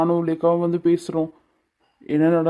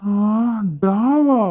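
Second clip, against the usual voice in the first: shocked; 4 semitones higher, much slower than usual. Acted. Husband shocked at being called da.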